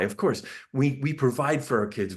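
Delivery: ordinary talk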